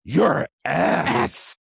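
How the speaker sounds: drawn-out mock outburst; retold outrage. Host voicing Tanya learning Aqua is a goddess, mock outrage. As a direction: act out indignant fury, stretching the last word. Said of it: "And Tanya's like".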